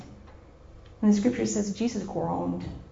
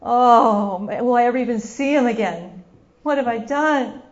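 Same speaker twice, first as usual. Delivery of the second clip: heartbroken regret; 7 dB louder than usual. Acted. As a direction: lament as if all is lost. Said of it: "her heart's probably breaking".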